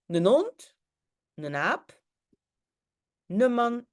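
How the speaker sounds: normal talking voice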